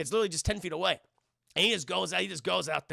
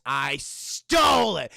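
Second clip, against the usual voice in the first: slow, louder emphasis on "stole"; defiant confession; 2 semitones lower, 8 dB louder, much slower than usual. Acted. Davey admitting to Whitey he stole it, drawing out "stole". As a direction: draw out "stole" loudly and proudly.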